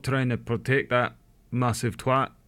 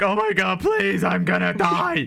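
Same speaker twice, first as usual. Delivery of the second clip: high, drawn-out pleading wail; hammed-up begging for mercy; inner desperation; 7 semitones higher than usual. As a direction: mock-desperate inner cry. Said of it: "Inwards, he's like".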